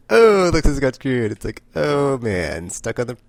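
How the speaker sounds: loud, high, slow excited exclaim. Retold or imitated. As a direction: gasp it out, thrilled. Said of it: "I was like".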